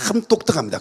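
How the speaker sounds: ordinary talk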